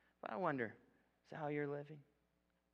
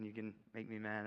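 ordinary talk, nothing marked

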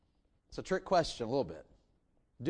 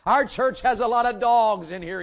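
louder, higher, preaching the line out; preacher's loud repeat; 6 semitones higher, 8 dB louder than usual. Retold or imitated. Retelling the Detroit preacher, a loud preaching repeat. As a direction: boom it to the congregation like the Detroit preacher. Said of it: "he said".

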